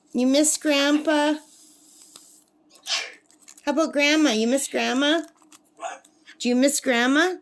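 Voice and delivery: lilting questions to a pet bird; pet-talk with a lifted, questioning tune; coaxing a pet. Real, not acted. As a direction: coax an answer from the bird. Asking her pet bird.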